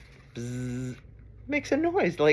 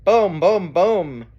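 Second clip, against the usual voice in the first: loud booms, each falling; thunder booms; 3 semitones higher, 6 dB louder, slower than usual. Acted. Reader showing Mister Brown making thunder, big loud booms. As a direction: boom like thunder. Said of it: "Mister Brown makes thunder".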